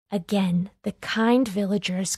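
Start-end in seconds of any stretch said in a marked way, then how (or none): none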